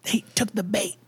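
hushed whisper through keyhole. Retold or imitated. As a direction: whisper it to your friends. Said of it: "I'm like".

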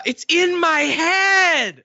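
high, loud, long-drawn anguished cry; John Malkovich. Acted. Podcaster quoting a film line, full-voiced anguished impression. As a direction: stretch every word in a wailing impression. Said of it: "you need to do your John Malkovich impression".

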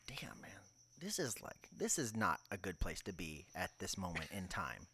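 soft, breathy-quiet aside to himself; hushed inner thought. Retold or imitated. Telling cohost his gym thoughts, hushed inner voice. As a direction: drop to a near whisper, confiding.

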